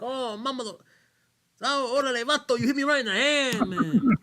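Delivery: loud, high-pitched Chicano accent impression; mock Mexican accent. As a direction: wail it in an exaggerated Mexican-American accent. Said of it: "doesn't think all Mexicans talk like that".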